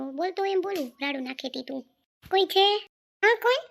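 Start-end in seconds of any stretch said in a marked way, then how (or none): 2.3-2.7 louder call at the door. Real, not acted. Tenant calling at landlady's door, loud call. 3.2-3.6 louder, higher reply from inside; calling back. Acted. Landlady answering the door call, louder and higher.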